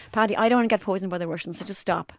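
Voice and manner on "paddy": ordinary talk, nothing marked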